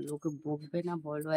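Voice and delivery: normal talking voice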